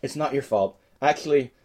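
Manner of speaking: normal talking voice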